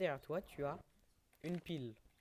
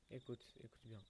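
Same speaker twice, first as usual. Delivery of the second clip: hushed; 4 semitones lower, 12 dB quieter than usual. Real, not acted. Listening for a game sound.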